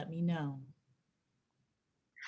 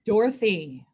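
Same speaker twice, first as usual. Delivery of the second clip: louder, stern call of a name; calling out sternly; 3 semitones higher, 7 dB louder than usual. Acted. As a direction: call out to get an employee's attention. Manager calling inattentive employee.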